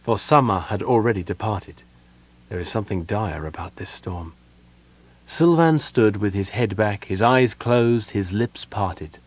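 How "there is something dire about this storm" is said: quiet murmur to herself. A narrator's reading. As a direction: say it under your breath. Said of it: "she added to herself".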